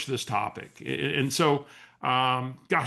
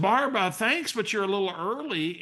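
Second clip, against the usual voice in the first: higher, amused polite correction; surprised thanks; 7 semitones higher than usual. Retold or imitated. To the caller wishing an early birthday, amused and higher. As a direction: thank her while gently pointing out the date.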